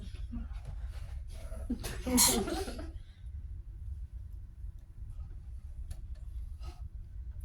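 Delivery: soft breathy laugh. Acted. Wife laughs at the dresses.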